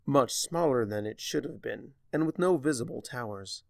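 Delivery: plain reading voice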